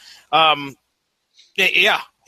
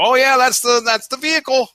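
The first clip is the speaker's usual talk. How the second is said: higher, louder mock excited onlooker; put-on eager recognition, pitched up; 6 semitones higher, 4 dB louder than usual. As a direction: exclaim it like someone spotting a famous car. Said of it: "and go".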